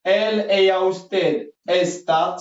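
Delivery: slow, drawn-out teacher modelling; slow rising list, like reading for the class; slow model drill. Shown as a demonstration. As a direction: stretch each form out for learners to copy. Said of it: "Sami يسمع مزيان".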